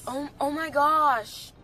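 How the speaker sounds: loud, slow, delighted gasp; excited. Real, not acted. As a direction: savor it out loud, thrilled. Tasting sauce, delighted.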